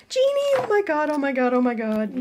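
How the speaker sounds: high, delighted shriek of joy; shrieking jackpot winner. Real, not acted. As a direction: squeal like you just won big. Gets the toy she wanted most.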